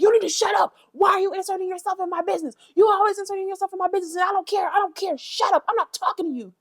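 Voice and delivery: high-pitched, rapid mock tirade; going crazy. Vlogger re-enacts Tinkerbella's outburst at Akbar, high mock fury. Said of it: "just starts going crazy on Akbar".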